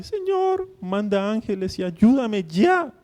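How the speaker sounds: high, loud whiny plea, dragged out; mock whining. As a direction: imitate a whimpering prayer, hammed up. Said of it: "No se puso a lloriquear".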